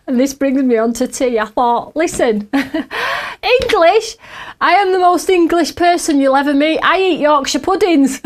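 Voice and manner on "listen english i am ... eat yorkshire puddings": high, loud, laughing protest; mock outrage, pitched up and loud; indignant outburst. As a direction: insist you're English, half laughing.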